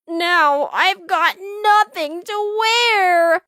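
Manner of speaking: mild groan over ruined costume. A narrator's reading. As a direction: complain like a sulky child. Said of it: "Splat groaned".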